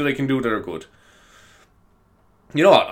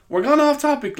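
mock scolding; 13 semitones higher than usual. Mock self-scolding aside.

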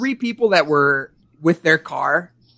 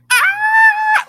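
high, loud horror movie scream; piercing mock scream; blood-curdling scream; 7 dB louder than usual. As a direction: scream like the classic horror-film blonde. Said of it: "blood-curdling scream".

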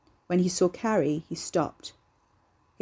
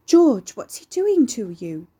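high, loud, slow worried call; sibilant woman; 4 semitones higher, 7 dB louder, slower than usual. Acted. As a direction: plead down from upstairs. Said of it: "a sibilant woman's voice".

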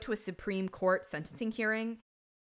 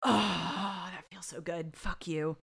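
gloating satisfaction; 2 semitones lower, slower than usual. Pretend. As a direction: exhale and savour the imagined victory. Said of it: "I think I'd be like".

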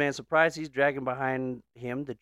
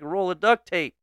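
raised, higher calling voice; yelled back; 6 semitones higher, 6 dB louder than usual. Acted. Boy answering the old man, yelled back. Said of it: "The boy yells back".

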